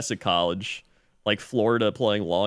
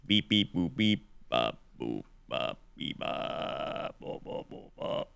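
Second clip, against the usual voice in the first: robotic beep-boop jingle, fading away; beeping jingle noises; 5 semitones lower, 7 dB quieter than usual. Pretend. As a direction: chirp it like a computer sound effect, fading out. Sports segment jingle imitation.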